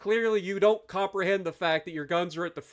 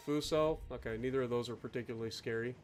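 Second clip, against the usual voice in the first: ordinary delivery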